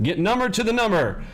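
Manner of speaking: number to the number, bright and emphatic; slogan, up-pitched. Shown as a demonstration. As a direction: punch it like a rallying line. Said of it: "Can you remember that?".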